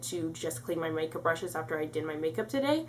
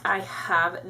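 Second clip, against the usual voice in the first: ordinary delivery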